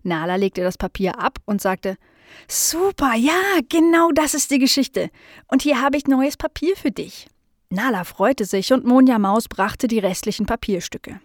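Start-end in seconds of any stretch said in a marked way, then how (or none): 2.5-7.3 high, gleeful exclamation; excited delight. Acted. Nala finds the right story for the mouse, excited. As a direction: pitch it up with happy relief.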